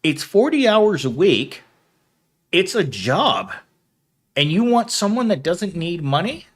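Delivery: arguing with the interviewer in disbelief; exasperated. Retold or imitated. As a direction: push back, incredulous. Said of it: "And I'm like".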